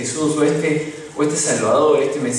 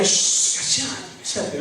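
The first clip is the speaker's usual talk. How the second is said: whispered, hissing hush; the crowd whispers to the beggar to shut up; 3 semitones higher, 5 dB quieter, slower than usual. Acted. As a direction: shush him like an annoyed crowd. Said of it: "los de la multitud decían".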